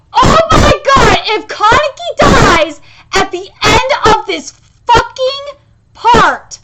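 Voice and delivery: loud, high distraught outburst; upset, mad. Real, not acted. Fan dreading Kaneki's death, upset and mad. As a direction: cry out, then slow down word by word. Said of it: "I'm going to be so mad".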